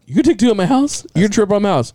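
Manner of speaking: high, disbelieving repeat of her words; incredulous. Retold or imitated. Telling his mom in disbelief, high incredulous question. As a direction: sound shocked she'd trip at your house.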